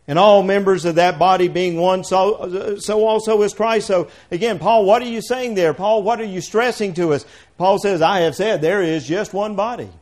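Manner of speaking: loud, driving preacher's reading; fiery scripture reading. Acted. Preacher reading Corinthians to the congregation, booming. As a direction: hammer home every "one".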